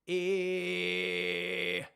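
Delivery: loud distorted false-fold sound; false fold distortion. Shown as a demonstration. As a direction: push a loud grit through the false folds. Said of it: "learn how to use your false folds".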